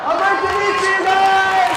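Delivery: high, loud, stretched call; real shout. Real, not acted. Comic calling to the audience over applause, shouted. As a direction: call out over a cheering crowd.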